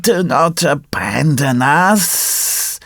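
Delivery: very slow, drawn-out, wailing plea; pitiful plea. A narrator's reading. Old fox begs the puppet to stay, pitiful. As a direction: drag out every word, begging.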